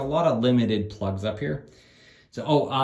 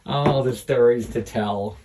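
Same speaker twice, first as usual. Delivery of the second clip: ordinary talk, nothing marked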